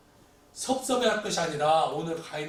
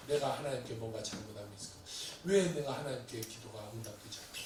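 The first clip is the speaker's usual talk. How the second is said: low, hushed self-examination; subdued inward pondering; soul-searching; 8 semitones lower, 7 dB quieter than usual. Acted. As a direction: question yourself humbly and softly. Models examining oneself before God.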